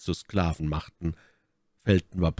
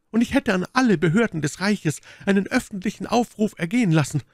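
hohe, heftig anklagende Frauenrolle; Milady, empört; 13 semitones higher, 4 dB louder than usual. Acted. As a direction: mit hoher Stimme gegen das Unrecht aufbegehren. (empörte, hohe Stimme der Milady)

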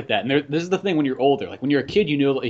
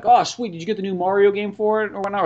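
feigned enthusiasm; 6 semitones higher, 4 dB louder than usual. Retold or imitated. Retells asking kid about game.

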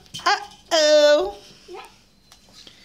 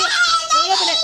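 higher, louder laughing plea; 11 semitones higher, 4 dB louder than usual. Real, not acted. Mother fending off grabbing kids, laughing and higher. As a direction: beg through laughter to be let through.

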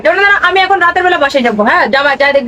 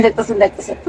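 fading mutter; 7 semitones lower, 6 dB quieter than usual. Acted. Mocking husband watching TV.

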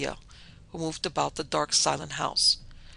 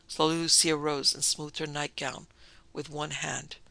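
ordinary delivery, nothing marked

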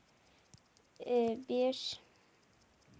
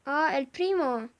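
calling out; 3 semitones higher, 7 dB louder than usual. Real, not acted. Calling out to a character.

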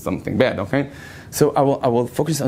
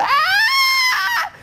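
shrill laughing scream; high scream. Retold or imitated. Girl screaming as the cockroach tangles in her hair, shrill scream. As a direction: let out a terrified shriek. She started shouting again.

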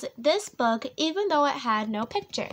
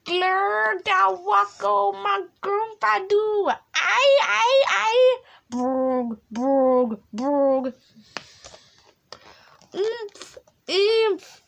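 silly sounds. Acted. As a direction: spit out wild nonsense words. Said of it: "make silly sounds like".

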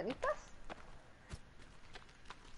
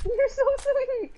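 mock swooning; 7 semitones higher, 3 dB louder than usual. Friend got her a gift.

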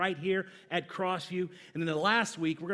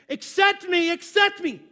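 mock pleading; 10 semitones higher, 7 dB louder than usual. Pleading for friend requests.

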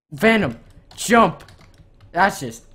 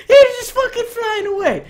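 outraged; 14 semitones higher, 6 dB louder than usual. Real, not acted. Outraged at hacker flying off.

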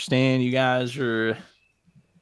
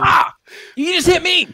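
very high, loud cry of protest; shrieked indignant complaint; outraged yell; 12 dB louder than usual. Acted. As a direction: yelp in shock at being hit. Hit by the boy.

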